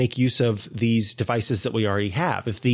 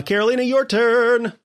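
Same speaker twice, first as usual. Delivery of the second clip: bright hand-off call; 12 semitones higher, 5 dB louder than usual. Real, not acted. Handing the turn over.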